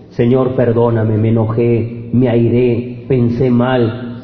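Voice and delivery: a hushed plea for forgiveness; humble prayer. Acted. Voices a prayer of repentance.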